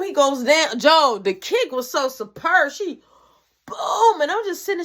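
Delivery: normal talking voice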